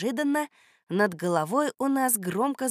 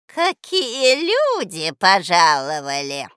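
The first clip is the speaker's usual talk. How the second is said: put-on slow, loud crooning old woman; sugary sing-song; 8 dB louder, slower than usual. A narrator's reading. Old woman greeting children at her door, sweetly sing-song. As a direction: croon a very affectionate welcome. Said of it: "очень ласковым голосом сказала она, словно пропела".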